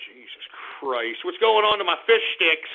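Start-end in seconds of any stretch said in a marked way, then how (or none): 0.1-1.2 low, soft, slow sighed oath; weary exasperation. Real, not acted. Host deflates after ranting at hypocrites, wearily exasperated. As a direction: sigh it out slowly under your voice.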